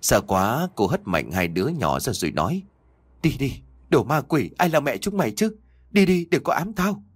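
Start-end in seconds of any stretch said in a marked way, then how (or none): 3.2-7.0 higher, climbing into a cry; woman screaming at the ghost children to leave; frightened angry shout. Acted. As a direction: shoo the ghost children off in panic. Terrified woman drives off ghosts.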